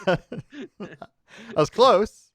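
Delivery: high, loud laughing exclaim; excited burst. Real, not acted. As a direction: blurt it out with a laugh. Laughing over a wrong guess.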